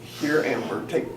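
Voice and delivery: slow, sulky sing-song handing over; mock a reluctant giver; hammed-up grudging "take this dollar". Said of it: "Not like".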